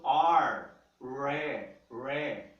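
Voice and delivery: "R" and "ré" with a loud retroflex caipira R; caipira R sound. Shown as a demonstration. Teacher shows students the countryside R, loudly. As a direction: roll the tongue back like a São Paulo countryside R. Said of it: "O R caipira do interior de São Paulo".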